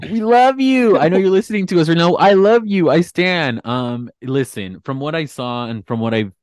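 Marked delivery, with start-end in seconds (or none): 0.1-3.6 loud, high squealing adoration; excited fan gushing. Real, not acted. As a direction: squeal it like a superfan. Gushing fan shout-out to Portia.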